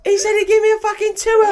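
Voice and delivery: put-on a Cockney little boy telling tales to his mum; Cockney child voice to his mummy; annoying Cockney child. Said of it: "annoying Cockney child accent".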